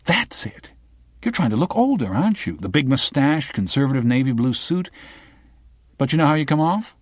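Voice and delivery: high, eager, a great discovery; light up as if you've cracked it; enthusiastic discovery. Acted. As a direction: reporter excited by her own insight. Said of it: "with some enthusiasm".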